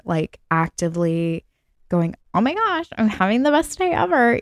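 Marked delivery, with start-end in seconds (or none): 2.3-4.4 high, bright mock gush; mock excitement. Podcast host mocking fake positivity, high mock excitement. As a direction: gush like someone faking a great day. Mocking a forced happy mindset.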